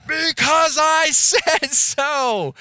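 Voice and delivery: hammed-up fed-up parent blowing up; exasperated parent shout. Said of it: "and calmly says".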